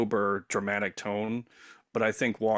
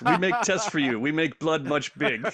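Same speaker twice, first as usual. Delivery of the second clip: high, broken foreign English; mock Igor, pitched up; Igor accent; 5 semitones higher, 3 dB louder than usual. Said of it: "I have one".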